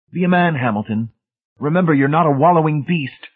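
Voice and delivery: high, preachy exhortation; moralize, pitched well up; sanctimonious. A narrator's reading. Brady urging Hamilton to be brave, sanctimonious. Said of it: "Brady said sanctimoniously".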